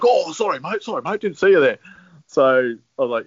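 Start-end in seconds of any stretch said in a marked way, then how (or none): 0.0-1.8 higher-pitched, insincere apology in imitation; fake apology. Rival to snubbed bowler after the handshake, mock apologetic. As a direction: put on a high, mock-sorry voice.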